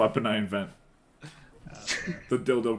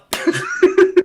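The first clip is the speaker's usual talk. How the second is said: loud laugh; 11 dB louder than usual. Real, not acted.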